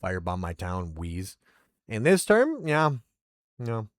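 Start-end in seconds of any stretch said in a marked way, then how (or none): none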